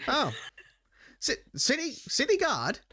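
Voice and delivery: high, prissy noble voice; haughty noble. Acted. Noble sneering at a guard, high and prissy. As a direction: pitch it up like a disdainful aristocrat.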